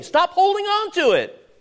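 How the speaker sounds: high, loud preacher's yell. Acted. Preacher yelling at himself before the congregation, loud and high. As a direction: cry it out hard at the flock. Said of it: "I'm not yelling at you".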